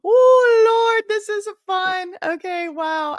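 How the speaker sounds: breathless glee at the total; overwhelmed joy. Real, not acted. Overwhelmed by donations, laughing.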